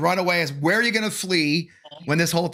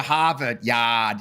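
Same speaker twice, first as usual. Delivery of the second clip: slow r-less "Harvard Yard"; dad's Boston accent; 4 semitones lower, much slower than usual. Retold or imitated. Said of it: "That's my dad".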